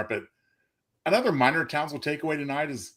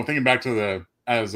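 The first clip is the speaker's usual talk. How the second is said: usual speaking voice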